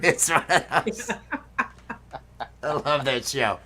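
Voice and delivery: normal talking voice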